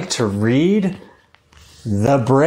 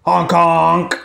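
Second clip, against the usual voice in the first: honking like a goose; loud, nasal goose call; 2 semitones higher, 4 dB louder than usual. Acted. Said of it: "It's Missus Goose".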